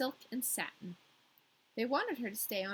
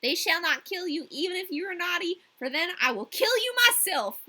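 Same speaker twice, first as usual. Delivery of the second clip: put-on high, loud threatening girl; sweetly menacing promise; robber girl; 8 semitones higher, 10 dB louder than usual. A narrator's reading. Robber girl warns Gerda, high and loud.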